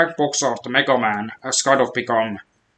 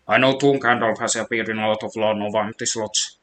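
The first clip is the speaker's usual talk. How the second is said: ordinary delivery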